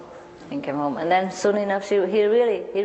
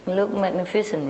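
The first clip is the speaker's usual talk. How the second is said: normal talking voice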